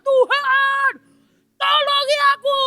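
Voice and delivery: high, loud panicked cry to God; desperate cry for help. Acted. Man dangling from a branch begs God, high panicked cry. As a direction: shriek for rescue from a cliff.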